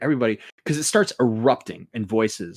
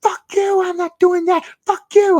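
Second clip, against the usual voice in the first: very high mock shouting; hammed-up high-pitched meltdown; freaking-out voice; 4 dB louder than usual. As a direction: imitate someone freaking out, way up high. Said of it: "he's just freaking the fuck out".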